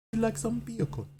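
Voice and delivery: put-on high, sing-song Jamaican accent; playful mock Jamaican offer. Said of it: "in a Jamaican accent".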